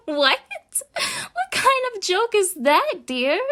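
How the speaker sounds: high, loud words breaking with laughter; near-hysterical laughing question; laughing disbelief. Acted. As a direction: laugh it off as a joke. Mocking the captive's wish for home.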